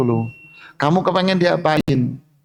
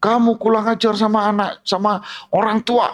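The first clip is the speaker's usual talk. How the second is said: higher-pitched mock scolding of a child; angry scolding parent; 7 semitones higher than usual. Preacher imitates a parent scolding a rude child, higher mock scolding. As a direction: play the parent telling off his kid, pitch up. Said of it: "Orang tuanya marah-marah sama anak".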